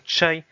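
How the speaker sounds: chai said with a sh onset and a glide at the end; model word chai. Shown as a demonstration. Teacher models chai for learners. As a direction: say chai clearly for the class. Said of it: "c'est le thé".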